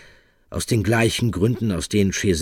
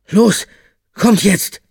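much higher, loud urgent shout; 11 dB louder, slower than usual. Acted. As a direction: yell it to the others. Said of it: "rief er den anderen zu".

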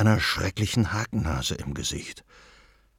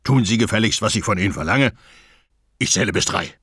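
louder robber command; gruff robber threat; 4 dB louder, faster than usual. A narrator's reading. Robber threatens grandmother, counting to three, loud. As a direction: order her around, menacing.

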